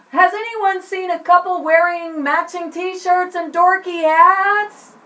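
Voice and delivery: put-on lost kid calling out to zoo crowds. Shown as a demonstration. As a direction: call to strangers across a crowd. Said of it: "they call out to the".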